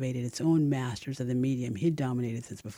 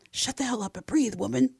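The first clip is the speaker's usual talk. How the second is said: higher-pitched inner scolding; mock inner scream; 10 semitones higher, 3 dB louder than usual. A narrator's reading. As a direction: snap at yourself in your head, pitched up. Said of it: "screamed the voice in my head".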